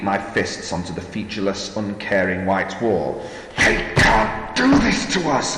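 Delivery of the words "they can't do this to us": high, loud, outraged yell; furious yell. A narrator's reading. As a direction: yell it in fury. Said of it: "I yelled".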